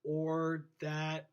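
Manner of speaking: drawn-out, word by word; talking too slowly. Shown as a demonstration. Teacher showing students what not to do, talking too slowly. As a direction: drag every word out very slowly. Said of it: "talking too slowly".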